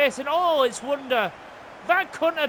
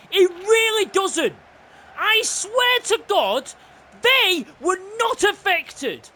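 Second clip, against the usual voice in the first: raised, exasperated complaint about the game; fuming rant; 4 semitones higher than usual. Real, not acted. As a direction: protest heatedly that it's unfair. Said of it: "I am fuming".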